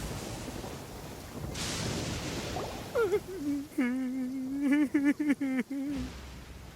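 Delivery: wheezing, choking laugh; pained laughing fit. Acted. Itto reels from the beans with a choking laugh. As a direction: laugh through the pain, helpless.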